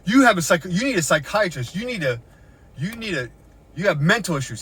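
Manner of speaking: loud, high mocking rant; officer yelling. Officer insulting her in the parking lot, loud and high. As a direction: hammer at her like the officer did. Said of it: "He goes".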